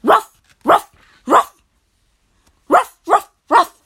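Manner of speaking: loud, high woof woof; barking dog. Acted. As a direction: bark like an excited dog. Dog barks.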